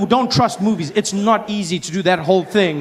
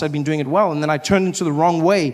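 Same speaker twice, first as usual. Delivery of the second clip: ordinary talk, nothing marked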